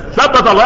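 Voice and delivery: loud, high ثبت with s, "sabbat". Acted. Teacher explains Umm Ayman's slip, s for th in ثبت. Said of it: "لسانها في الثاء".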